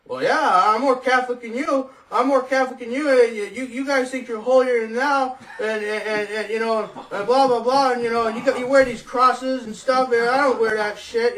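put-on loud, high drunken rant. Drunk neighbor confronting the narrator, loud and high. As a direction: rail at him like an angry drunk. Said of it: "He gets right in my face".

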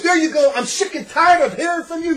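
loud, high fed-up yell; retold yell. Richie yelling at the speaker, loud fed-up. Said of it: "he's yelling at me".

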